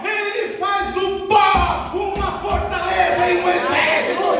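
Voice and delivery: high, loud preaching at full force; fiery preaching. Acted. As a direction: preach it to the rafters, building. Preaching crescendo.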